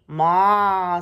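loud, drawn-out bleat; goat's maa. A narrator's reading. Goat greeting the truck, loud long maa. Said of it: "said the goat".

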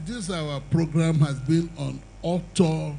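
ordinary delivery

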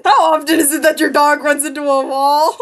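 loud, through real laughter; loud question breaking up with laughter; laughing. Real, not acted. Laughing on call with friend.

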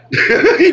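sudden high burst of laughter; quick real laugh breaking through; short high laugh. Real, not acted. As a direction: laugh out loud at your own point. Laughs at own rant.

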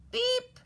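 very high, short beep; little truck beep. A narrator's reading. Blue answers the animals, high little beep. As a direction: squeak out a tiny horn beep. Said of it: "said Blue".

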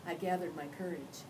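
ordinary delivery, nothing marked

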